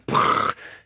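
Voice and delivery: sputtering burst of mouth noise; imitated distorted speaker. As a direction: blow out a crackling speaker noise. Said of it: "front door speaker was like".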